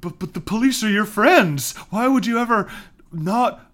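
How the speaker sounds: high, stammering mock bewilderment; imitating naive disbelief. Sam can't grasp distrust of police, mock-bewildered. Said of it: "Sam just fully doesn't understand people".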